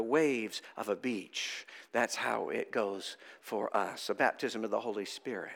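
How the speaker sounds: slow and soft, easing down; tender. Real, not acted. Preacher softly describing grace to the congregation, slow and tender. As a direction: let it wash over them gently.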